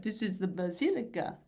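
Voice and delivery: put-on weak Italian accent, "basilica" barely changed; a half-hearted Italian accent on "basilica"; bad Italian accent. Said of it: "not in a good Italian accent".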